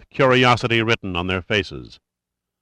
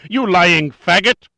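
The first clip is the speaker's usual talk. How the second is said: much higher, louder, slow yell; shaking with fury; 9 semitones higher, 6 dB louder, slower than usual. A narrator's reading. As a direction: scream it, trembling with rage. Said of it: "his voice shaking with fury".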